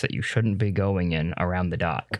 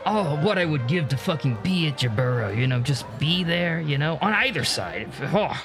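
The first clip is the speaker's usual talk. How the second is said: starstruck fanboy. Acted. GM voices fanboy Lon Doc.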